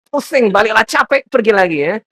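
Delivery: loud, high mock complaint; high-pitched acted nagging at full volume. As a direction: act a fed-up spouse raising the voice. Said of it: "dapat nada yang tinggi".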